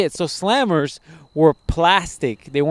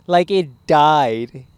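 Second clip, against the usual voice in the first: slow emphasis; 2 semitones higher, much slower than usual. Real, not acted. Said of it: "stretched dramatic telling".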